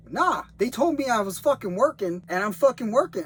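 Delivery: high, loud indignant shout; angry shouting. Retold or imitated. Guy shouting at the sheriffs, high and loud. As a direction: insist you're still working, loud. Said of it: "'Cause he was like".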